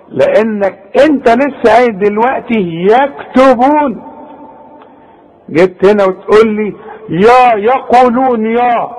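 ordinary delivery

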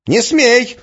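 high, loud cry of protest; exclaiming. A narrator's reading. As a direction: cry it out to stop him. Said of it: "воскликнула Арина". (отчаянное восклицание)

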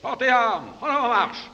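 barked drill commands, high and loud; stiff barking toy soldier. Acted. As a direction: bark orders like a drill sergeant. Military commands to "mon petit régiment.

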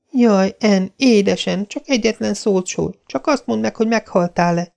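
put-on high pleading girl voice; Diana desperate. A narrator's reading. Diana begging fallen Anne, high and desperate. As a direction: beg her to say one word. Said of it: "kétségbe esetten".

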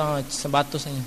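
a husband quietly agreeing he was wrong; low, soft mock concession; conceding husband. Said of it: "تو بولتا،".

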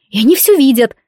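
loud cutting retort; sharp retort. Acted. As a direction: snap it back loudly. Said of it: "отрезала я".

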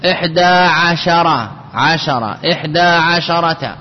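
عشرة with an open "a" after the sh; shin with fatha. Shown as a demonstration. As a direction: say عشرة as "ʿa-sha-ra(ta)". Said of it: "لبعض تميم فتحها". (نطق عشرة بفتح الشين)

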